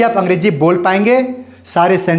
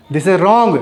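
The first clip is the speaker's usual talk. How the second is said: usual speaking voice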